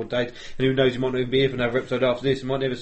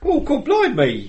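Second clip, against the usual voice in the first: higher, slow hammed-up English exclamation; mock English accent; 12 semitones higher, 4 dB louder, slower than usual. American playing an Englishman, hammed-up English accent. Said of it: "impression of an English person".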